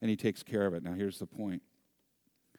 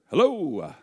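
big, high, booming phone hello; jovial dad; 10 semitones higher, 11 dB louder than usual. Retold or imitated. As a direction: answer the phone as a cheery father, loudly. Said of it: "his jovial".

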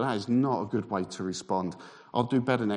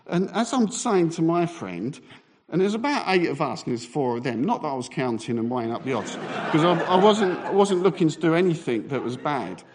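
ordinary delivery

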